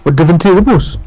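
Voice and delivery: thick Geordie phrasing; Geordie accent. Acted. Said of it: "Mean Geordie accent".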